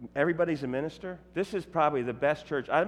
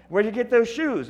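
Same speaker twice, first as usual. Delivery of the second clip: imitating the heckler; 10 semitones higher, 5 dB louder than usual. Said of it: "heckler again".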